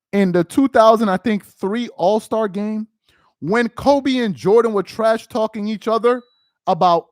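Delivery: ordinary delivery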